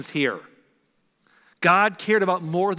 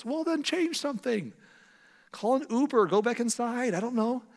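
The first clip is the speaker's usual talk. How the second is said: high-pitched incredulous exasperation; exasperated advice; 8 semitones higher than usual. Acted. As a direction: toss out obvious fixes in a high voice. Exasperated advice addressed to Jonah.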